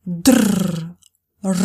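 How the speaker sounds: ordinary talk, nothing marked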